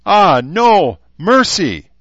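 high, loud, drawn-out begging; mock plea for mercy. Herzer teasingly begs the woman, loud mock plea. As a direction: cry out for mercy in playful alarm.

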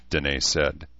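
usual speaking voice

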